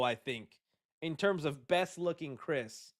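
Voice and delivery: normal talking voice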